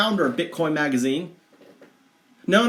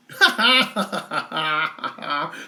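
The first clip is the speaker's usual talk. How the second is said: amused laugh. Real, not acted. Laughing at the horse logo.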